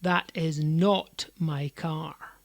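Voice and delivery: slow, weighty NOT, every word separate; defensive stress on NOT. Shown as a demonstration. Accused of driving an awful car, defensive stress on "not". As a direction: deny it defensively, leaning on "not". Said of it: "It feels kind of defensive".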